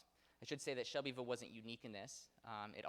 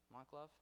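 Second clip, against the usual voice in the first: softer "Mont Glove" with a questioning rise; unsure check; 4 semitones higher, 8 dB quieter than usual. Real, not acted. Lecturer asking listeners if the pronunciation is good, unsure check. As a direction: float "Mont Glove" quietly upward, seeking approval. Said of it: "We're good with that".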